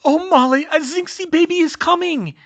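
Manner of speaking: high, loud panicked wail; woman in labour, voice shooting up; panicked labour cry. Acted. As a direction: cry out in pain and alarm. Fleur going into labour, panicking.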